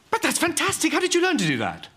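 high, gushing wonder; thrilled, high-pitched admiration; amazed excitement. Acted. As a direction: gush in astonishment. Amazed by trick with cat.

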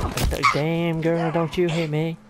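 high, loud sassy drawl; hammed-up diva telling her off; sassy girl voice. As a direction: camp it up, scolding the attacker. Sassy put-on voice at attacker.

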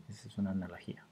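unvoiced whispered aside to the class; hushed side comment. Real, not acted. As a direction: drop to a whisper for the remark. Teacher aside after analogy.

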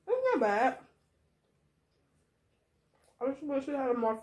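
talking between bites of sandwich; chewing while speaking, muffled; mouth full, chewing. Real, not acted. Seen 0: Holds sandwich near mouth, lips closed chewing, hand covers mouth later.

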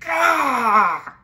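re-enacted loud scream of exasperation; loud, drawn-out "aah" of pent-up frustration; frustrated yell. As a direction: burst out in a high, falling cry of frustration. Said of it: "where I've been so frustrated".

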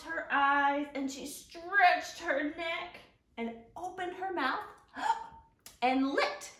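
expressive storybook reading, lifting up on the exciting parts; reading to children. Acted. Teacher reads a picture book aloud to the kids. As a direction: read it to the little ones with wide eyes.

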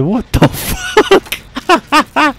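very high, drawn-out mock disbelief; killer's reaction. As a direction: stretch it out like a shocked killer. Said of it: "He said".

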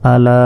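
"আলা" with the final vowel held long; three-alif elongation. Shown as a demonstration. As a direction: stretch "লা" in "আলা" on a level note. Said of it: "তাই তিন আলিফ পরিমাণ টেনে পড়তে হবে". ("আলা"-র "লা" তিন আলিফ টানা)

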